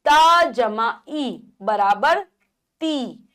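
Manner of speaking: very slow, each part held apart; slow spelling out. Shown as a demonstration. Teacher slowly spelling a syllable for children. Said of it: "ई की मात्रा इस प्रकार".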